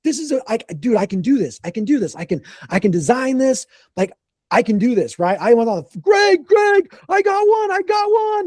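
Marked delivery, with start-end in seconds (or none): 0.0-5.2 higher, giddy self-pep talk; buzzing after landing the sale; retelling his own thrilled inner voice; excited. Retold or imitated. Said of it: "So I was all excited". 6.0-8.5 much higher, louder call to his partner; thrilled calling out Greg's name; excited shout. Retold or imitated. Calling out news of sale.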